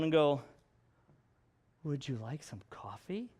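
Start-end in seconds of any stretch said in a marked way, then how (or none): 1.8-3.3 creepy, much softer and lower offer of coffee; a hushed, creepy "Would you like some coffee?". Shown as a demonstration. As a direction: lean in and make it unsettling. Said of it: "That's kinda creepy".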